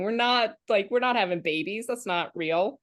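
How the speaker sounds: ordinary talk, nothing marked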